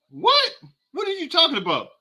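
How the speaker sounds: a sudden high "What?" then a disbelieving follow-up; stunned disbelief. Real, not acted. Streamer reacting to a viewer's comment, in disbelief.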